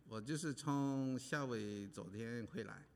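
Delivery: usual speaking voice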